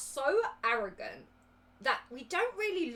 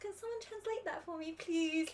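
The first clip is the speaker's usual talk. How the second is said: softer, higher helpless plea; 6 semitones higher, 5 dB quieter than usual. Retold or imitated. A judge at a convention asking for a translation, helpless. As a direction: ask helplessly for someone to translate. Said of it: "I'm just like".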